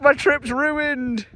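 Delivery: high, drawn-out teasing impression of woe; hammed-up retold lament; mock despair. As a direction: wail it slowly, teasing him. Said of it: "He was like".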